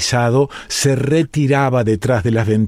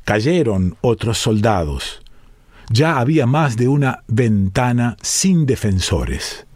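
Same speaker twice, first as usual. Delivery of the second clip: usual speaking voice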